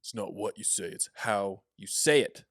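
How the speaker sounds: slow, weighty stress on "how" and "say it"; drawn-out, forceful emphasis landing on "say it"; intense. Shown as a demonstration. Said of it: "That's much more intense".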